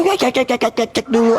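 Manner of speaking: mocking imitation. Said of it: "Ngapain kau bilang".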